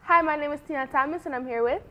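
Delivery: ordinary delivery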